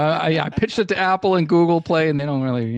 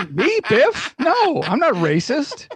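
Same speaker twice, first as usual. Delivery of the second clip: lightly voiced second host, higher and a touch slow; Biff voice; 9 semitones higher, slower than usual. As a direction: play a defensive co-host, mostly his own voice. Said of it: "This was [name] the entire time".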